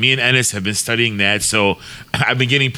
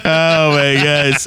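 drawn-out exclamation; 2 semitones higher, 3 dB louder, much slower than usual. Real, not acted. Reacting to accent remark.

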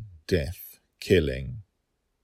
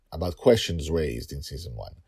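ordinary delivery, nothing marked

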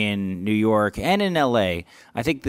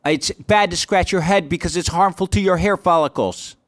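louder, higher put-on heckler voice; heckler firing back; 8 semitones higher, 5 dB louder than usual. Audience member snapping back at the comic, firing back. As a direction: fire it back in another man's higher voice. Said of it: "he fires back at me".

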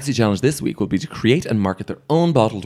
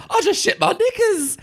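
high squealing impression of the queen; quoting Cheryl Hole. Cohost quoting a drag queen's catchphrase, squealed high. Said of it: "Cheryl Hole's".

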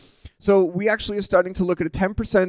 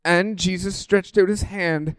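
slow, solemn reading; scripture reading; 4 semitones higher, slower than usual. Acted. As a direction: read the text reverently. Reads scripture slowly.

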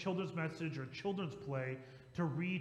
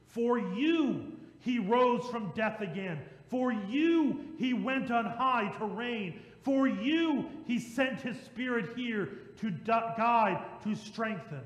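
each "For you" punched higher and louder; emphasis on for you; 3 semitones higher, 4 dB louder, slower than usual. Shown as a demonstration. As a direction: drive every "For you" straight at the listeners. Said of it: "Do you hear the emphasis?".

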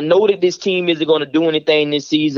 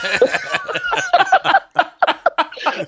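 long, much higher burst of laughter. Real, not acted. Fan laughing off dread of the next game, high laughter. After the Ravens news.

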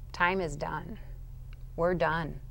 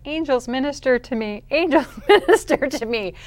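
higher-pitched mock plea, breaking into a laugh; switched pleading tone; 6 semitones higher, 5 dB louder than usual. Tormented woman begging angels to minister, switched pleading tone. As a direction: flip suddenly into a high little appeal. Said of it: "I would say in the next tone".

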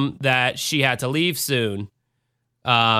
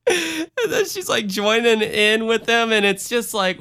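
laughing; 8 semitones higher than usual. Real, not acted. Laughing at absurd scene.

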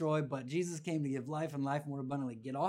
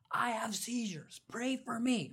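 high, slow, drawn-out call; imitated kid's yell; 9 semitones higher, slower than usual. Kid at church asking for prayer, imitated high yell. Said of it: "this guy yells. He goes".

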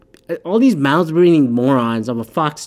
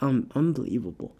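much softer, deflated reaction; hushed disbelief; 2 semitones lower, 11 dB quieter than usual. Retold or imitated. Speaker reacts to people still undecided, quiet disbelief. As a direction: drop the volume as if at a loss.

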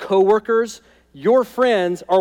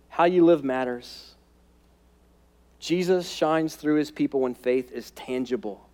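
ordinary talk, nothing marked

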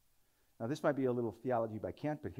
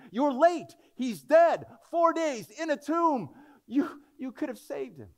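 much higher, louder accusing crowd; accusing townspeople; 11 dB louder, slower than usual. Retold or imitated. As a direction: throw the blame at him. Townspeople blaming Jesus.

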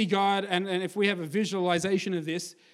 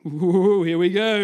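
excited crowd; 9 dB louder, slower than usual. Retold or imitated. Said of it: "They're like".

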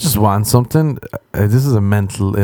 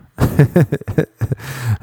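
higher chuckle at his own story; laughing after the punchline; amused laugh; 5 semitones higher than usual. Real, not acted. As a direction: let out a real chuckle. Laughs at the leather joke.

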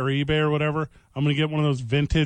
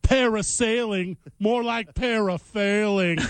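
slow punny commentator; 3 semitones higher than usual. Pretend. Mock commentator pun.

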